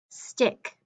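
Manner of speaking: normal talking voice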